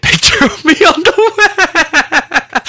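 high, loud, slow giggling confession; laughing embarrassment. Real, not acted. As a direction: squeal it out mid-laugh. Embarrassed joke about posing.

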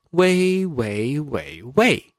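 "way" in each Mandarin tone, one after another; four Mandarin tones. Shown as a demonstration. Host demonstrating tones for caller's question on "way". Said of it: "those are the four tones".

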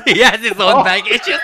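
laughing through the words. Real, not acted. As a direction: giggle while saying it. Laughing repeat of tease.

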